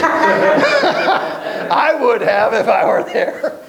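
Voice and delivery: slow, chuckling through the words; laughing. Real, not acted. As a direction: laugh at your own joke. Preacher laughing at his own joke.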